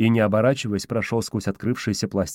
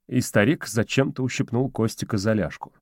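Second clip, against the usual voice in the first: ordinary delivery, nothing marked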